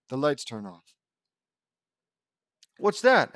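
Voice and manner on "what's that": high, loud startled question. Acted. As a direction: jump at a sudden sound. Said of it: "asks Hank".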